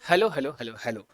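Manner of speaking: soft, low repeated phone greeting; everyday phone hello. Retold or imitated. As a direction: toss off hellos as if on a call. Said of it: "करते होंगे daily". (धीमी, नीची आवाज़ में phone वाला hello)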